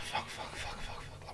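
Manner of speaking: rapid whispered curses; panicked whisper. Retold or imitated. Panic in front of audience.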